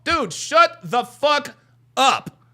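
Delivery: normal talking voice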